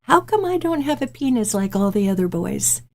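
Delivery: put-on high, small child's voice asking innocently; little girl voice. Daughter asking mom from the back seat, high little-girl voice. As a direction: play the young daughter, pitched well up. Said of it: "I hear this little girl voice go".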